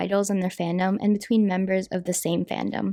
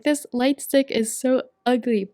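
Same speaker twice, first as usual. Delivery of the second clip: slow snooty complaint; mock hater; 4 semitones higher than usual. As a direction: sneer it like a snob. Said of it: "so they're always like".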